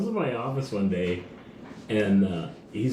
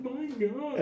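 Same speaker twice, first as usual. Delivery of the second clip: high mock gasp; 12 semitones higher, 6 dB quieter than usual. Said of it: "I was like".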